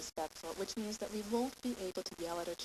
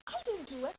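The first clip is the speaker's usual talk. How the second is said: put-on higher-pitched child protesting; defensive child; 8 semitones higher than usual. Child talking back to a yelling parent, defensive. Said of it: "inviting them to say".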